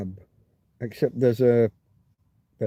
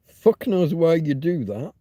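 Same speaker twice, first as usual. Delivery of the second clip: usual speaking voice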